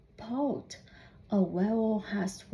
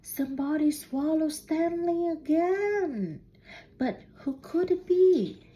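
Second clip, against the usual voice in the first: higher, louder suspense refrain; dramatic refrain; 6 semitones higher, 3 dB louder than usual. A narrator's reading. Dramatic refrain of read-aloud story.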